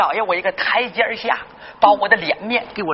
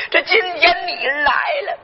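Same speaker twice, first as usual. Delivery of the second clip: higher, crying out with emotion; tearful cry out; 8 semitones higher than usual. Acted. Grandfather to his grandson at their reunion, crying out. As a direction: let the voice break on it.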